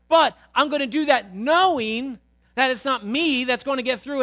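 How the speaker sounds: loud, high, driving sermon voice; full-force preaching. Acted. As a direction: preach it out to the congregation.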